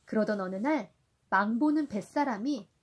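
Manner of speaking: gentle storybook reading for a little one; reading to a toddler. A narrator's reading. Parent reading Sindbad to a child. (아이에게 동화 읽어주듯)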